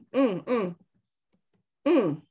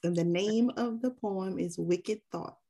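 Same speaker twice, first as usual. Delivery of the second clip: normal talking voice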